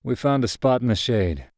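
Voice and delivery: ordinary delivery, nothing marked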